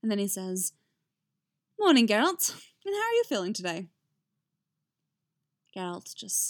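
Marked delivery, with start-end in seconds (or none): 1.8-3.9 high, loud, bright-risen morning hello; cheery greeting. A narrator's reading. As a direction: sing out a lively good morning. Bard's cheery greeting in narrated scene.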